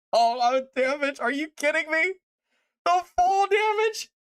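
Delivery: rising pitch of can't-believe-it; incredulous outrage. Real, not acted.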